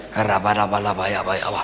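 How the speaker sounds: loud, slow fake tongues; loud mock tongues. Pastor mocking a prophet, loud tongues. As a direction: belt out nonsense prayer.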